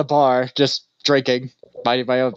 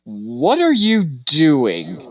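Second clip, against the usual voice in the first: slow question; much slower than usual. Retold or imitated. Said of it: "and he goes".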